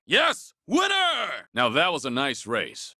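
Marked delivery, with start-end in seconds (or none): 0.1-1.5 high, jubilant yelps; victory whoop. Acted. Racer crossing the finish line, whooping. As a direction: cheer the win at the top of your voice.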